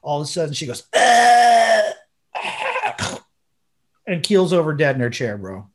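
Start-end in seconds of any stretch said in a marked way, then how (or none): 0.9-3.2 loud gasp, then a strangled high gasp; imitated choking gasp. Mimicking Linda's final gasp before collapsing, choked and high.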